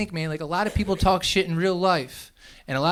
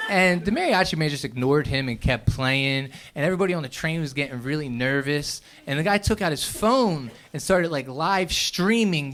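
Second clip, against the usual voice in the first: ordinary talk, nothing marked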